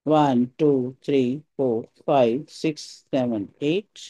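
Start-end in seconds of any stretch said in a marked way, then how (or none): none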